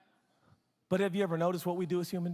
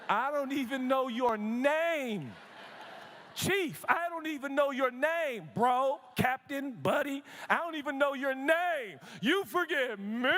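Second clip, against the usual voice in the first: higher, slow indignant disbelief; mock offended; 6 semitones higher, slower than usual. Pastor answering the young man, mock offended. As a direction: act insulted, drag it out. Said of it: "I was like".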